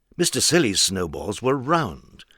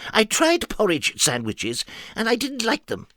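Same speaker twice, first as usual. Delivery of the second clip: high, earnest nonsense character; high silly voice; 12 semitones higher than usual. Acted. Mister Nonsense explaining himself, high and earnest. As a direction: say the daft logic perfectly seriously, up high.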